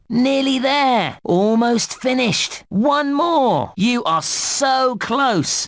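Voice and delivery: high, drawn-out cheer; excited encouragement. Acted. Game helper cheering the player near the goal, excited encouragement. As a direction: urge them on with long, high words.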